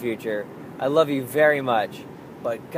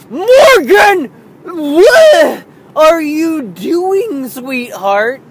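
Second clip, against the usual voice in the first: much higher, louder, slow burst of her name; sudden yell; 13 semitones higher, 13 dB louder, much slower than usual. Retold or imitated. Dad's reflexive outburst at his daughter, yelling then softening. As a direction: blurt it out like a tic, then soften on sweetheart. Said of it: "sound like I have Tourette's".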